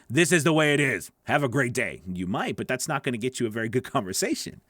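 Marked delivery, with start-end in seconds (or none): 0.1-2.0 firm, final declaration that leaves no room for reply; authoritative, case closed. Shown as a demonstration. Coach shows learners what not to say, authoritative and final. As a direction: state it loudly, then close it off. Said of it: "which means you don't say".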